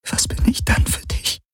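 soft unvoiced whisper. Kirai asking Galen what she means to him, whispered. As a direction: whisper it close, barely audible.

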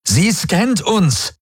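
high, drawn-out alarmed call; breathless cry. A narrator's reading. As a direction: call it out urgently, stretching the words. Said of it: "rief Galen atemlos". (aufgeregter, gedehnter Ausruf)

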